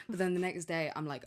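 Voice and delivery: normal talking voice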